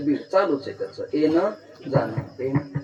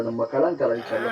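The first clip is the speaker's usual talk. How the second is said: normal talking voice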